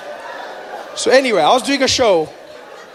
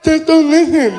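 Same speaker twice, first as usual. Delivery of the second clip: higher, exasperated imitated deaf speech; partially deaf man; 5 semitones higher, 3 dB louder, slower than usual. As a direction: coach him insistently in a deaf man's accent. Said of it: "I'm partially deaf".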